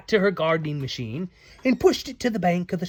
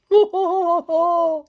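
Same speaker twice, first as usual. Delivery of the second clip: high giggle; 14 semitones higher, 8 dB louder than usual. Acted. Beck laughs in character.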